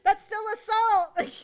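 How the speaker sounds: squeaky, loud laughing objection; laughing protest. Real, not acted. Laughing at a friend's joke about hitting back, loud high squeal. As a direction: protest through giggles, way up high.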